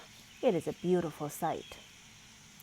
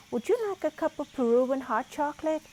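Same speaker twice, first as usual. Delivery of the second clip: friendly fan; 8 semitones higher, 4 dB louder than usual. A narrator's reading. Fan offers hot chocolate.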